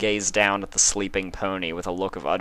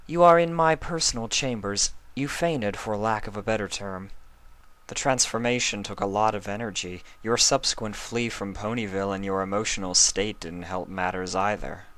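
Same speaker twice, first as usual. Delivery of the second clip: Luna, gentle princess; 3 semitones higher than usual. Acted. Luna welcoming Spike back, relieved and gentle. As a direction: speak as a kind princess to a waking dragon. Luna's voice again.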